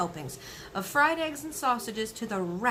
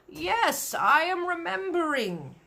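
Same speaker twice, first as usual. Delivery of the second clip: higher, slow giant drawl; friendly giant voice; 8 semitones higher, 4 dB louder, slower than usual. Acted. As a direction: dredge it up slowly, like a big gentle giant. Giant recalls slowly.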